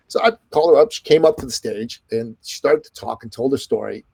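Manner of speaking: ordinary talk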